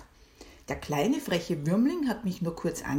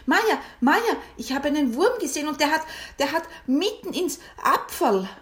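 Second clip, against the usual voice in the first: high, loud, breathless rush; excited; 10 semitones higher, 6 dB louder than usual. Acted. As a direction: blurt it out all excited. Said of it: "Ich war so aufgeregt". (ganz aufgeregt)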